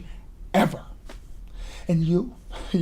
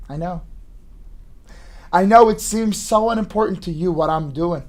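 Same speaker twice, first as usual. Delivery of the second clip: usual speaking voice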